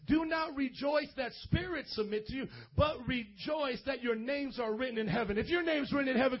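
normal talking voice

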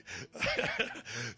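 breathless, voiceless laugh; short laughing aftershock; laughter. Real, not acted. Pastor laughing after apologizing, breathless chuckle.

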